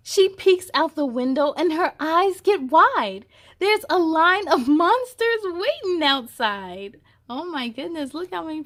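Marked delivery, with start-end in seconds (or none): 0.1-7.0 lively picture-book reading, a bit higher and louder; storybook narration. A narrator's reading. Reading a vet story aloud to kids, lively storybook narration. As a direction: read it like a bedtime picture book.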